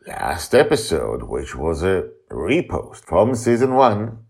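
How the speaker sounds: usual speaking voice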